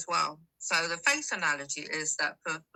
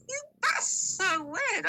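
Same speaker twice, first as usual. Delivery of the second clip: amazed; much slower than usual. Retold or imitated. Amazed at the coincidence.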